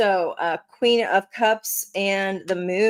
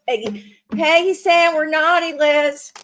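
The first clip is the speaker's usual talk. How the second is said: high, louder tattletale voice; sibling tattling; 10 semitones higher, 5 dB louder than usual. Pretend. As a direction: tell on them like a bratty sibling. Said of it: "That was my Beth Tattletale voice".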